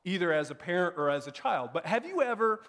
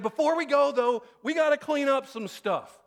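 higher and louder, dad laying down the rule; firm announcement; 8 semitones higher, 4 dB louder than usual. Retold or imitated. Dad announcing chores to kids, loud insistent. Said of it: "You know what I'm saying?".

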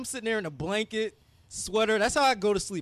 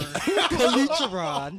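announcer voice; 5 semitones higher, 4 dB louder, much slower than usual. Pretend. Host announcing guest names.

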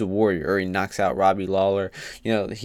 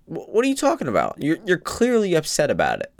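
incredulous; 8 semitones higher than usual. Retold or imitated. Joe's reaction in retold talk.

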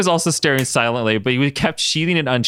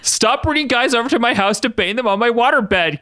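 pleading; 12 semitones higher than usual. A narrator's reading. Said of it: "Katie pleaded".

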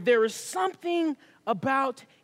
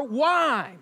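ordinary delivery

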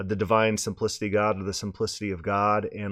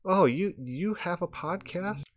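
higher, lightly voiced young woman, curious; a hint of a surprised girl asking; curious girl's voice; 8 semitones higher, 3 dB quieter than usual. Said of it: "And she's like".

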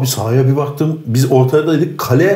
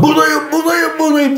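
much higher, eager call for the ball; mock calling for the ball. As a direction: shout for the ball like an overeager player. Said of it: "diye topu alıyordun".

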